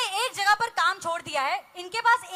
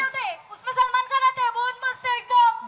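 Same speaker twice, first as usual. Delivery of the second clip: mock delight; 4 semitones higher than usual. Shanta Bai gushes over Bigg Boss and Salman Khan, giddy and laughing. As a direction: gush like a maid promised Bigg Boss.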